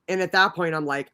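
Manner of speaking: usual speaking voice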